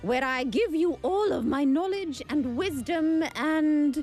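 put-on showman accent; a hammy game-show host; Doctor McLucky voice. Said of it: "let me take my accent back to Laura".

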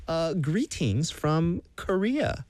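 slow, high mock British accent; butchered Harry Potter British accent. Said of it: "I always butcher my British accent".

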